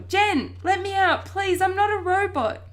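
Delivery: high, loud plea; desperate yelling. A narrator's reading. Boy trapped in cupboard begging his family, yelling. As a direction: holler to be let out. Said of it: "I yell".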